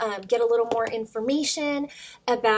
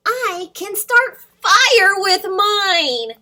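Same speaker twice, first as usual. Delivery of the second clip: dragon boast; 9 semitones higher, 10 dB louder, much slower than usual. Acted. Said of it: "Diggory Doo declared".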